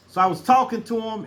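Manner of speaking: normal talking voice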